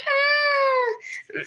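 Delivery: high, breaking mock-sob "Ah"; a playful, exaggerated crying cry; mock crying wail. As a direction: imitate yourself welling up at a movie. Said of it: "I cry about everything".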